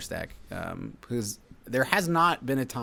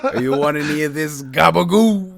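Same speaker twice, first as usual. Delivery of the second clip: hammed-up Italian mobster, louder and slow; mock mobster; 6 semitones higher, 12 dB louder than usual. Clerk bullying the tenant as a loud, slow mock mobster. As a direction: lay on a cartoon New Jersey mobster threat.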